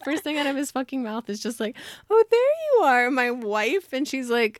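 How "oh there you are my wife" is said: high, loud, drawn-out "oh, there you are"; hammed-up delighted husband greeting; gleeful mock greeting. Luke greets Addie on the train as his wife, hammed-up and gleeful.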